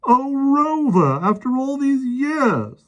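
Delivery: high, slow tearful cry; sobbing joy. A narrator's reading. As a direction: weep with happiness over him. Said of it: "the second policeman sobbed".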